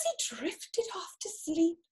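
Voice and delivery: hushed bedtime whisper. A narrator's reading. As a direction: whisper him off to sleep. Bedtime reading, falling asleep.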